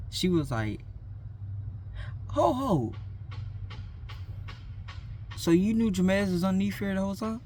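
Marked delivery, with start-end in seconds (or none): 2.3-7.4 put-on suspicious girl's voice. Girl catches narrator lying.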